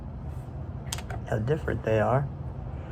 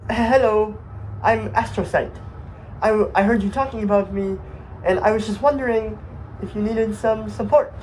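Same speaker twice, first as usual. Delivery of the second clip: higher, hesitant little voice; timid Astrocyte, eager to help; 7 semitones higher than usual. Acted. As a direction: offer help shyly, tripping over words. Plays the shy Astrocyte.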